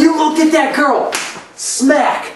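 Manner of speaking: high, loud mock outrage; overly dramatic. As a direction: shriek it like a melodramatic teen. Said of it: "that kind of overly dramatic".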